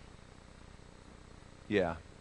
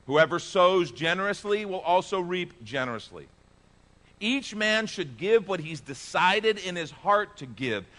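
loud, high, slow scripture reading; preaching scripture; 9 semitones higher, 6 dB louder than usual. Acted. Pastor reading scripture aloud to the church, preaching at full force. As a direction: proclaim the verses to the congregation.

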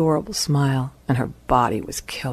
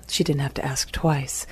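ordinary delivery

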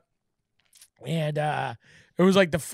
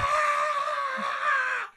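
put-on high squeal of a thrilled kid; kid's excited scream; 4 dB quieter than usual. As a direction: shriek like a boy getting his dream gift. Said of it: "He starts screaming like".

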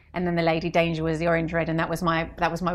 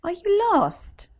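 put-on much higher, slow, sing-song question to a child; kindly shop assistant; 13 semitones higher, much slower than usual. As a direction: talk down gently to a lost little girl. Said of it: "the consultants would be like".

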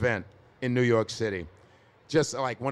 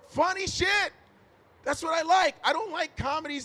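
fired-up shout; 13 semitones higher than usual. Real, not acted. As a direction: passionate outburst. Ranting about funny sitcoms.

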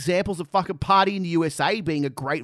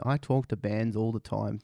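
slow, low, dull parody of Finn's line; mocking quote; 7 semitones lower, 6 dB quieter than usual. Mocking Finn's argument in a slow, low, dull voice. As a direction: drag it out like a tired old excuse. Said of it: "The old-fashioned ... argument".

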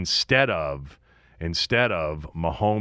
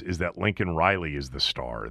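usual speaking voice

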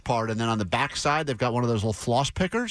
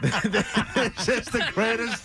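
laughing; 9 semitones higher, 3 dB quieter, much slower than usual. Real, not acted. Host laughing at caller's idea.

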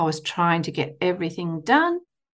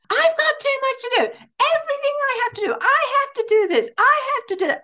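much higher, tight, overwhelmed voice; frazzled inner voice; 3 dB louder than usual. Retold or imitated. The harried voice in her head protesting, much higher and stressed. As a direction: squeal it like a stressed-out mum with too much to do. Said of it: "this other voice in my head goes".

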